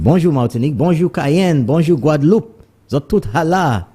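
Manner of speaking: high, bright calls to the islands; excited greetings. Real, not acted. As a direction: call out greetings high and lively. Radio host greeting French islands.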